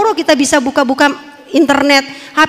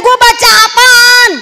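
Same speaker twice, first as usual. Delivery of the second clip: mildly irritated question, slow and higher; 8 semitones higher, 5 dB louder, slower than usual. Retold or imitated. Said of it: "Saya bilang".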